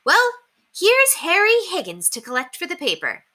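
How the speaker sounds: kindly lady welcoming the paperboy, high and sweet; Miss Peabody voice. A narrator's reading. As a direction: greet the paperboy with a chirpy lilt. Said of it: "She opened the door and said".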